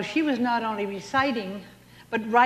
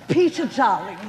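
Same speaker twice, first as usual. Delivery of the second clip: Bette Davis impression; 6 semitones higher than usual. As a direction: spit it out like a grand old film star dumping her husband. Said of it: "Perhaps you recall Betty Davis".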